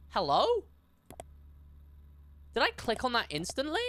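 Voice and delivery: ordinary talk, nothing marked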